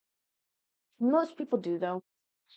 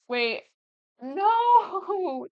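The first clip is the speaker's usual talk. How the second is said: high, loud, drawn-out no; stretched cry of dismay; real dismay; 10 semitones higher, 5 dB louder than usual. Real, not acted. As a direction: wail it as you realize you forgot. Realizes she forgot the photo.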